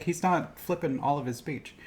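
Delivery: normal talking voice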